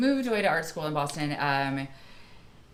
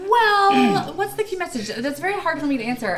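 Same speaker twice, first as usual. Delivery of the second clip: startled high repeat of the question; flustered, high, fast stalling; caught off guard; 11 semitones higher, 5 dB louder than usual. Real, not acted. Surprised by hard question.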